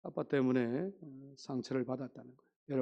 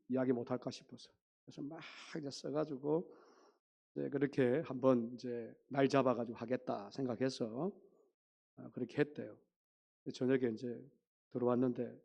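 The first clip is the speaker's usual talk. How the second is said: normal talking voice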